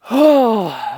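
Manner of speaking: big loud exhale to catch breath; heavy sigh. Real, not acted. Winded vlogger pausing to recover, heavy sigh. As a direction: let out a long, winded sigh.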